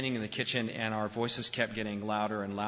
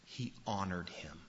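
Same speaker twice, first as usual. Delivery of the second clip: much softer, lower answer; hushed answer; 4 semitones lower, 8 dB quieter than usual. Acted. Pastor answering his own question, softly. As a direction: drop to a near hush.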